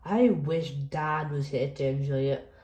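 ordinary delivery

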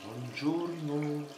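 drawn-out greeting. Real, not acted. Drawn-out morning greeting on camera.

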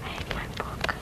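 whispered aside, barely breath. Real, not acted. Boy reading aloud whispers a grumble about his book. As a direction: mutter it under your breath to nobody.

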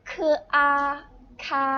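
คือ and R held apart, then joined as a long คา; sounding out a syllable. Shown as a demonstration. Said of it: "วิธีการออกเสียงก็คือ".

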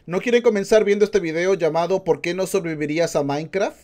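usual speaking voice

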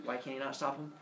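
plain reading voice

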